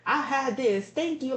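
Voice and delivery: high, curt, stuck-up reply; mock a rude customer brushing you off; snobby quote. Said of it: "They were just like".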